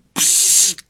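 long hissing air sound; hissed psst. A narrator's reading. Little old man interrupted while holding breath, hissing. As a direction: let air out in a hiss. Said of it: "udělal maličký".

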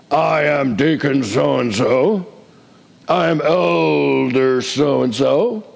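slow, stretched-out title announcement; hammed-up self-important introduction; mock pompous. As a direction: draw out each title with puffed-up importance. Mocks people using titles for recognition.

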